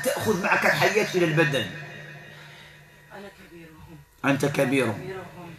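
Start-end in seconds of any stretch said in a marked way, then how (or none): 3.1-4.0 distant, hushed possessed voice; thick strong voice. Real, not acted. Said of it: "بصوت غليظ قوي". 5.0-5.5 distant, hushed possessed voice; thick strong voice. Real, not acted. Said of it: "بصوت غليظ قوي".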